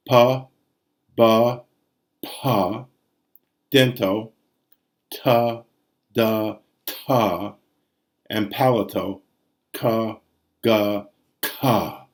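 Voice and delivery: crisp separate pa, ba, ta, da, ka, ga; stop consonant demo. Shown as a demonstration. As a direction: pop each stop clearly. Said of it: "So you have labio".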